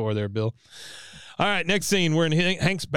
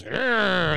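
mock battle grunt; 6 semitones higher than usual. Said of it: "you hear, uh, Bill".

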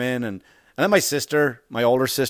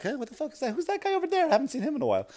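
feigned innocence; 14 semitones higher, 4 dB quieter, faster than usual. Retold or imitated. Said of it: "And I would just look away like".

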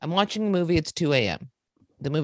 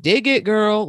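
mock catcall, higher and louder; loud, pitched-up catcaller imitation; 5 semitones higher, 7 dB louder, slower than usual. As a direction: imitate a man calling out to a passing woman. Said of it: "somebody being like".

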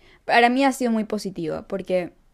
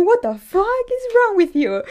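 very high, loud, drawn-out indignation; retold outraged outburst; 13 semitones higher, 6 dB louder, slower than usual. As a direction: act out disbelieving outrage, stretching the words high. (indignación imitada, muy aguda y fuerte)

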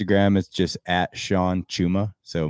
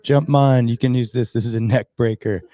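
higher, louder, buddy offering parachute; skydiver quote; 6 semitones higher, 5 dB louder than usual. Retold or imitated. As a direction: shout it out mid-freefall. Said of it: "They're like".

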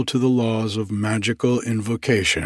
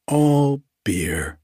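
lightly cried out, pitched a bit higher; a half-hearted exclamation; mild cry; 5 semitones higher than usual. A narrator's reading. Turkey explaining himself to his employer, mildly cried. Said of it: "cried Turkey".

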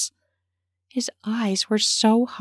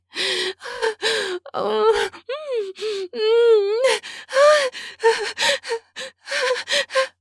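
high pleasured moans and panting; moaning and gasping. A narrator's reading. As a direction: pant and moan high. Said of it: "moaning and groaning".

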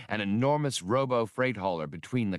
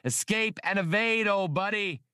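much higher urgent rallying shout; urgent exhortation; 10 semitones higher than usual. A narrator's reading. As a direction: spur your partner on at the top of your voice. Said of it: "Han exhorted his partner".

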